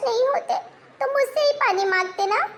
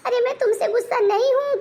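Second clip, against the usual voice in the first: usual speaking voice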